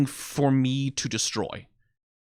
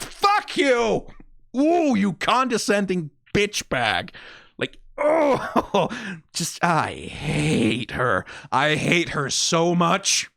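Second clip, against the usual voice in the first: high, slow, heated yelling; real furious venting; angry outburst; 4 semitones higher, much slower than usual. Real, not acted. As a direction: let the hatred rip. Raging at a hated character.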